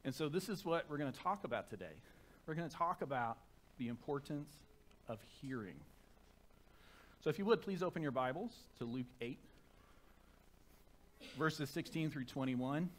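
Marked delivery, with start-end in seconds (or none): none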